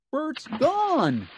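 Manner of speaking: high puppet wail; Ernie wailing. A narrator's reading. Ernie finding Bert missing, high wail. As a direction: cry out in panic as Ernie. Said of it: "Ernie wailed".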